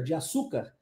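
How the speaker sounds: ordinary talk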